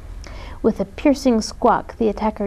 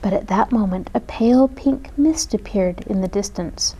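usual speaking voice

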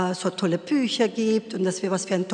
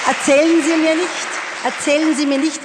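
louder, higher, hammering erzählen each time; heated retort; 4 semitones higher, 6 dB louder than usual. Retold or imitated. Mother telling off the teacher, loud and heated. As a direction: lose patience and hit erzählen twice. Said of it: "etwas zu forsch".

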